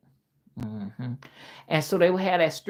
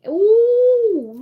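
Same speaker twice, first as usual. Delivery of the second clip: delighted ooh; 13 semitones higher, 6 dB louder than usual. Real, not acted. Praising thick old bacon.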